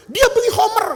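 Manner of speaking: high, loud accusation; accusing. Acted. Townsman telling the master of the offence, accusing. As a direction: call out the charge high and loud.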